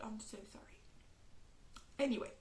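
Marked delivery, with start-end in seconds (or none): 0.0-0.8 sheepish whisper, softer; whispered apology. Real, not acted. Apologising for series talk.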